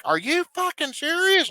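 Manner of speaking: retold outraged judge exploding; imitating a furious friend's disbelief; mock blow-up. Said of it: "Like, blows up".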